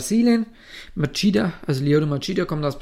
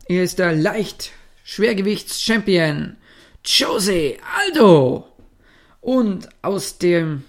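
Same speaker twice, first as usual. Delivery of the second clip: slow, louder announcer introduction; boxing ring announcer; 2 semitones higher, 4 dB louder, slower than usual. Retold or imitated. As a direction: call out the fighter's name like a ring announcer. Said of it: "stellt er natürlich noch die ganzen Leute in den Ecken vor". (Ringsprecher-Ansage)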